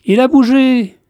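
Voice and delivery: put-on small girl thrilled the penguin moved; excited child. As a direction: burst it out like a thrilled little girl. Child sees penguin move.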